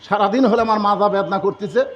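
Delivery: ordinary delivery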